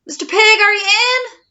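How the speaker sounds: calling loudly to the pig. Acted. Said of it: "I called".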